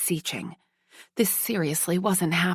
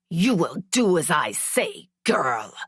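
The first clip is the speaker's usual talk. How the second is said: spat. Acted. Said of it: "he spat in my face".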